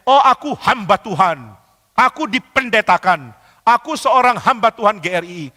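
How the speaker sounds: puffed-up mock declaration; mock self-important. Imagined self-important servant of God.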